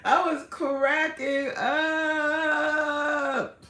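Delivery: high, hugely stretched final word; very slow, high-pitched amused exclamation; exaggerated drawl. Real, not acted. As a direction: drag it out for comic emphasis. Exaggerates how much she laughed.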